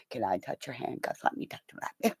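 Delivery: very soft, hushed pleading; sly request. Retold or imitated. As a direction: ask under your breath, playfully. Acted sly request to officer.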